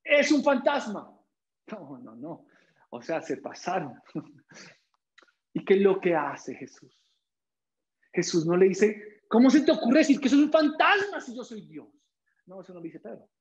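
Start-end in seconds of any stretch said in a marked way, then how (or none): 0.0-1.2 mock fearful cry. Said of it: "¿Y saben qué le dicen?". 9.3-11.9 mock indignant. The reply Jesus does not give.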